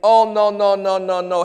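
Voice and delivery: ordinary delivery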